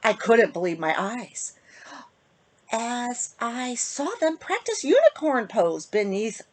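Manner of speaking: ordinary delivery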